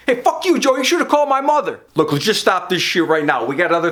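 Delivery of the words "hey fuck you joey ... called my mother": high-pitched, quick angry retort; acted angry outburst. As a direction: cut in angrily, pitch jumping up. Acted quarrel with Joey over mother.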